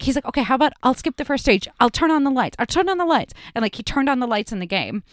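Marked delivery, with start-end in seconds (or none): 0.3-3.3 higher, quick calming offer; hurried reassuring voice; soothing staffer. Retold or imitated. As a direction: try to calm a panicked player. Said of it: "he's just like".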